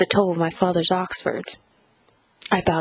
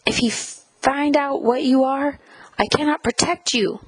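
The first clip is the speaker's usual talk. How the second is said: urgent, strained warning, pitch raised; restrained scream-whisper, higher pitched; 6 semitones higher than usual. A narrator's reading. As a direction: warn your child desperately, close to tears. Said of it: "she scream whispered and started to cry".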